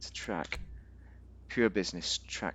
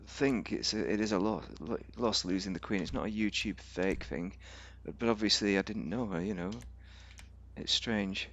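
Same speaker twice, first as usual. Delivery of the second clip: usual speaking voice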